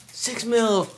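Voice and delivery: cheering loud and high; excited. Pretend. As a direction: celebrate like you just won. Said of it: "pretend like we just did it".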